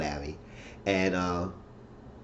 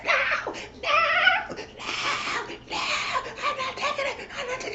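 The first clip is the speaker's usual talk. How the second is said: mock squeaky refusal; 4 dB quieter than usual. Tanya refuses Bennett's pills again, very high and giggly. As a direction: stretch the no's like a stubborn child, then fade softer.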